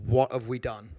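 dropped low in mock dread; low aside, as if regretting it; retold dismay. Retold dismayed aside to his wife.

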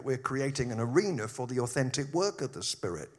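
ordinary delivery

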